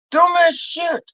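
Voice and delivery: high-pitched "Dumbass shit"; exasperated repeat. Acted. Comic repeating his verdict on Santi, high exasperation.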